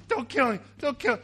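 loud, high begging; mock pleading. Bowing brother begs approaching brother for mercy, mock pleading. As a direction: plead in a hammed-up panic. Acts out Jacob begging again.

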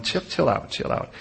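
soft, hushed reassurance; softly telling them to calm down; soothing steward. Pretend. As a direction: settle them down quietly. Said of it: "the steward's going".

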